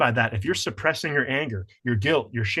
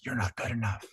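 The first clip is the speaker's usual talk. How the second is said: soft hushed critic voice; inner critic whisper; 8 dB quieter than usual. Retold or imitated. The inner critic whispering doubt, soft and hushed. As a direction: hiss it quietly from the back of the mind. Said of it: "You hear it, like, whisper".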